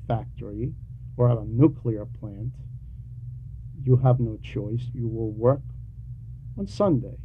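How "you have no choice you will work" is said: usual speaking voice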